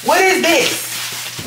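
high, slow, drawn-out what is this; disbelieving shock. Real, not acted. Came for cream cheese, stares at the haul, drawn-out shock.

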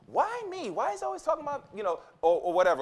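high, whiny mock complaint from the women; hammed-up indignant sister; complaining woman. Preacher mimics women asking why him, high and whiny. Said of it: "women often ask".

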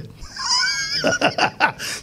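airy high burst of laughter; preacher laughing at the mask plan; breathy high laugh. Real, not acted.

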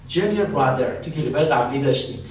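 ordinary talk

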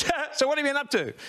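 quick, high imitation of Farnham. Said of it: "he says".

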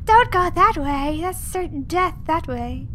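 high, loud warning of alarmed viewers; mock-yell a warning at the player; imitated loud yelling. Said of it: "you were all yelling very loudly".

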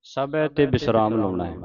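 usual speaking voice

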